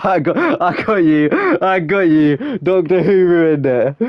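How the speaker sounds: slow, amused repeat; laughing realization. Real, not acted. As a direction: laugh as it clicks.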